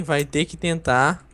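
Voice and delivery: slow, drawn-out nudge ending on a rise; slow teasing repeat. Real, not acted. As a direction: stretch the words out like a teasing dare. Repeats encouragement, drawn out slowly. (devagar, arrastado e provocador)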